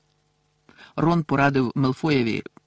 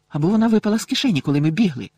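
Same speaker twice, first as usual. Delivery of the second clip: higher, fast worried rush; alarmed; 5 semitones higher, 5 dB louder than usual. A narrator's reading. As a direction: blurt it out in alarm. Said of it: "стривожилася Герміона".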